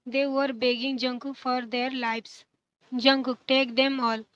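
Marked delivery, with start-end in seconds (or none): 2.9-4.2 cold voice. A narrator's reading. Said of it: "cold voice".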